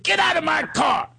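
high-pitched mock angry shout; hammed-up furious order; imitated yelling. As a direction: act out throwing someone out. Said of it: "He starts yelling at me".

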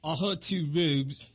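low, soft attempt at a British accent. Shown as a demonstration. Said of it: "let's have them each say a sentence".

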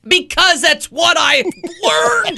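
loud, high, drawn-out retort; mock outburst. Real, not acted. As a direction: stretch it out in exasperated self-defense. Mock outburst answering a teasing question.

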